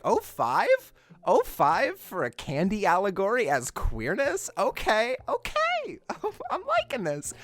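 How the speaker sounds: high, rising impressed voice; pleasantly surprised. Retold or imitated. Watching the film, pleasantly surprised rising voice. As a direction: perk up, impressed. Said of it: "I'm like".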